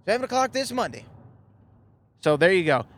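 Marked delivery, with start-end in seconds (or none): 0.1-1.0 put-on higher folksy old-timer reply; lightly voiced folksy conductor; train conductor voice. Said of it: "this train conductor".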